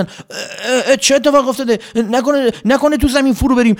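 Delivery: high loud alarmed voices; frightened crowd. Acted. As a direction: panic about sinking into ground. Army panics about sinking.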